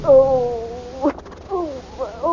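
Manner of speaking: breathy, fearful oh sounds as the plant squeezes; frightened gasps. Acted. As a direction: gasp in fear and pain. Princess trapped by crushing plant.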